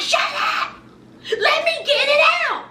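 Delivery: high shrieking yell, voice cracking; yelled quote. Retold or imitated. Wife yelling at husband during fight, shrieking. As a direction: shriek it out like she's had enough. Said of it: "she was like".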